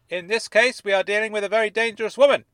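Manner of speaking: loud, firm and fast; firmly. Acted. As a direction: lay it down with authority. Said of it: "said Dennis firmly".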